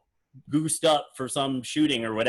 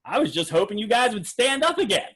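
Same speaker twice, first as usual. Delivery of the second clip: higher, louder playful impression of the magician; a light, lifted mock of the showman asking for another ovation; imitating Mac King; 8 semitones higher, 4 dB louder than usual. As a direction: tease the crowd like a cheeky performer. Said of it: "he goes".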